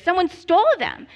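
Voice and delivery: ordinary delivery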